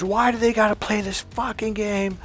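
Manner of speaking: imitating an angry complaint, pitched high; mock exasperated protest, high but not shouted; pissed-off arguing. Friend arguing outside about the girls, mildly mocked pissed-off whine. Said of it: "he's just all pissed off".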